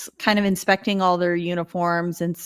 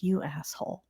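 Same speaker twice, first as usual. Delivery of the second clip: softer, under-the-breath jab; muttered insult; 10 dB quieter than usual. Retold or imitated. De Silva insulting the colonel under her breath, quiet. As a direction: slip it out quietly. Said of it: "she's like".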